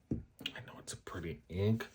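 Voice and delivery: soft whispered aside "I know it's a pretty ink". Real, not acted. Vlogger whispering to herself about the ink. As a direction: whisper it to yourself.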